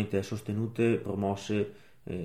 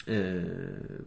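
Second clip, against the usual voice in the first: ordinary talk, nothing marked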